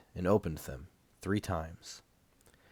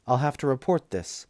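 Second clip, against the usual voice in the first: voiced nurse; 6 semitones higher than usual. A narrator's reading.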